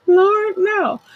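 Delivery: high squeal of mock horror; squealing Lord no. Real, not acted. Host reacting to her own joke about meds with a high squeal. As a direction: shriek it like you can't believe it. Repeating the reaction while laughing.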